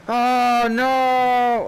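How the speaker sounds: high, drawn-out wail; long high yell of dismay; loud dismayed cry. Real, not acted. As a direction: wail it at full voice as it goes wrong. Sudden setback in game.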